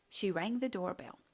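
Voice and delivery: ordinary delivery, nothing marked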